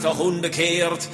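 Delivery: normal talking voice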